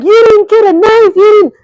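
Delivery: almost yelling at the memory; exasperated outburst. Retold or imitated. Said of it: "I'm like".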